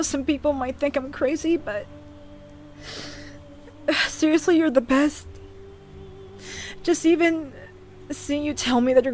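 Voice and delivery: sighing, choked-up thanks; real tears held back; tearful. Real, not acted. Streamer thanking Josh, crying a little. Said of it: "crying like this".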